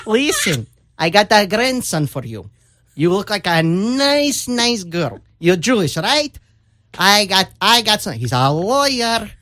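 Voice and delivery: put-on old Yiddish grandmother accent. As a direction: play the pushy Yiddish grandmother at the bakery. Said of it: "Oh, you're so good".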